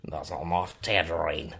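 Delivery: low, hushed mock Australian; [name]. Shown as a demonstration. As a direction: demonstrate softly in a deep Australian accent. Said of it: "how to speak Australian".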